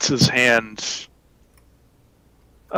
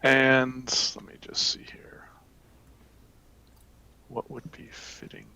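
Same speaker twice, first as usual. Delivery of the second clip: muttering to self; 8 dB quieter than usual. Real, not acted.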